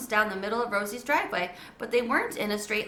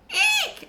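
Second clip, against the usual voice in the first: very high frightened squeal; squeaky eek; 5 dB louder than usual. A narrator's reading. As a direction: let out a tiny panicked squeak. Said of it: "I shouted".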